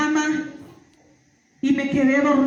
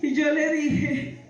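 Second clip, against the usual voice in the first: ordinary talk, nothing marked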